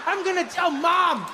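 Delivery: high-pitched tearful mock accusation; indignant child. Little boy scolding the dad who left him, high indignant whine. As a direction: whine it like a betrayed little kid. Translating the crying boy's accusation.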